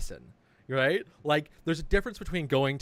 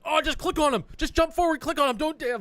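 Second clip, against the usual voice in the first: high, loud barking coach orders; bossy coach voice; 6 dB louder than usual. Retold or imitated. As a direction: mimic an impatient coach, high-pitched. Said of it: "this guy's like".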